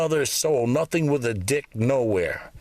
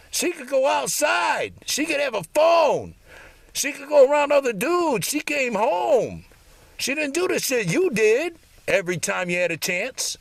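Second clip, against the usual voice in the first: angry rant; 10 semitones higher than usual. Real, not acted. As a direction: shout out the bitter comparison. Ranting about former good wife.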